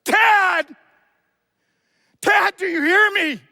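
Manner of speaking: high, loud shout for Dad; desperate cry. Acted. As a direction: shout as if calling a father who may not hear. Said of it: "I could translate that and say".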